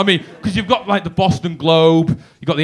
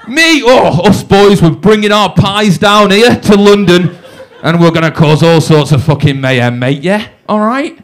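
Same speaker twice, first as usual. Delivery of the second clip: loud gang-boasting swagger; big yourself up loudly as a would-be gangster; hammed-up hard-lad threat; mock tough bravado; 6 dB louder than usual. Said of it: "I wanna look tough and stuff".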